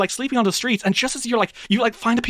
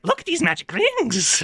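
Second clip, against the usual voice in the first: goblin voice; 6 semitones higher, slower than usual. Acted. Goblin pushes the rings again.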